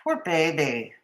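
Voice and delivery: low, soft mock sympathy; quiet sarcastic afterthought; mock pity. Real, not acted. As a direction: feign pity under your breath. Repeats the mock pity, lower.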